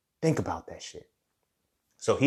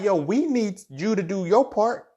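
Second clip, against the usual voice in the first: higher, slower voice telling you to do your part; the universe insisting, higher and drawn out; voicing the universe; 11 semitones higher, 4 dB louder than usual. The universe telling the listener to do their part, higher and slow.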